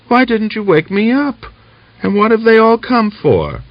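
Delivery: put-on a small girl put out at not being woken; vexed little girl. A narrator's reading. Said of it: "said in a vexed tone".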